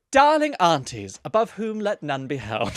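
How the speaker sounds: hammed-up elderly lady. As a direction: read it as a grand old auntie. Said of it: "my elderly lady accent".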